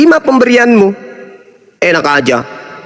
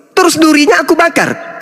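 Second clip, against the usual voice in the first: ordinary delivery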